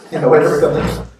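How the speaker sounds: laughing loudly through the words. Real, not acted. Teacher laughing at the list of passing thoughts, laughing loudly. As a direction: let a big laugh carry the line. Laughing after listing mind's chatter.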